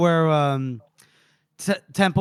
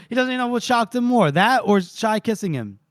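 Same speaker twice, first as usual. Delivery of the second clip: ordinary talk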